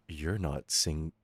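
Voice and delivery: slow unvoiced Southern-free whisper; breathy slow whispered retort; whispered comeback. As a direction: whisper the jab slowly. Hitting him with a comeback.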